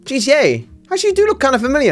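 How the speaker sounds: high, fast tailor voice; imitating the tailor. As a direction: mimic the tailor recognising him. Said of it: "And he's like".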